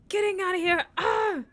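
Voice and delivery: put-on distressed teen. Imitating distressed sibling.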